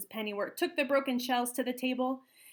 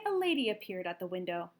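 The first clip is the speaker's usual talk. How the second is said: usual speaking voice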